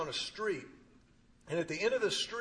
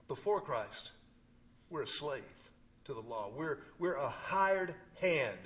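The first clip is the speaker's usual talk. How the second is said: usual speaking voice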